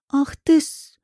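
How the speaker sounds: high, strained angry hiss; vicious hiss. A narrator's reading. Coach hit by the woman's handbag, vicious hiss. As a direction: hiss it and cut off. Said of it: "шипит он злобно".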